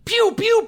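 high, loud playful pew pews; pew pew gun sounds. Pretend. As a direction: fire off cartoon laser sounds. Gun noises summarizing the comic.

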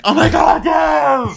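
very high, loud shout of glee; mock cheer. Podcaster mocks the girls cheering for pizza, loud high squeal. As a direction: squeal it like a crowd of fans.

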